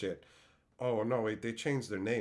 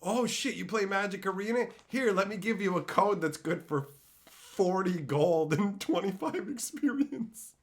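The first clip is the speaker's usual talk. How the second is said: mock IHOP worker; 7 semitones higher than usual. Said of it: "And they go".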